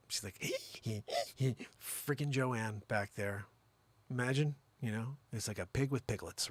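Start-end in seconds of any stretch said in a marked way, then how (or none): 0.4-1.6 squeaky mock wheezing; imitated wheeze. Joanne hears four kids news, mock wheezing. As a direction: wheeze in little mock gasps.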